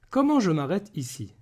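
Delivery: usual speaking voice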